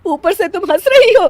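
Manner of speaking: loud, pained complaint rising in pitch; aggrieved mother-in-law; indignant scolding. Acted. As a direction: scold her for laughing at your pain. Mother-in-law scolds laughing daughter-in-law.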